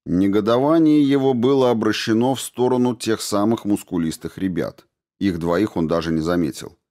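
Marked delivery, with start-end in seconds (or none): none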